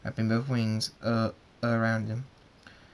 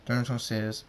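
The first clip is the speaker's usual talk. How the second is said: plain reading voice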